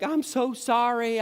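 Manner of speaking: high, drawn-out apology; apologetic calling across. Retold or imitated. Driver saying sorry to a yelling neighbor, high and slow. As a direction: stretch out a high-pitched sorry. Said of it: "and I'm like".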